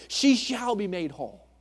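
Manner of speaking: "She shall be made whole" proclaimed loud and high; loud triumphant promise. Acted. Jesus assuring the struggling believer of the promise, loud and high. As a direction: ring out "made whole" as a victory.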